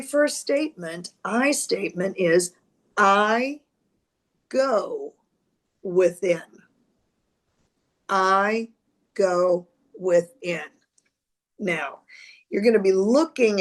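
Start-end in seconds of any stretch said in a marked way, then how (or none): 3.0-6.4 slow, word by word with long pauses; slowly. Real, not acted. Astrologer dictating a statement for viewers to write down, slowly. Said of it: "I'm gonna say them slowly". 8.1-10.7 slow repeat, a gap between words; slowly. Real, not acted. Astrologer repeating the statement for note-takers, slowly. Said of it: "I'm gonna say them slowly".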